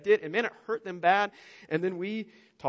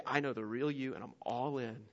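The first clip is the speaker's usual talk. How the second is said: softer, lower, close and intimate; tender whisper of love; 4 semitones lower, 10 dB quieter than usual. Acted. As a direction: say it like a secret promise. God speaking intimately to you.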